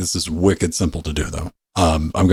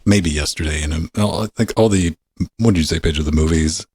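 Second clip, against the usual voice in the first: normal talking voice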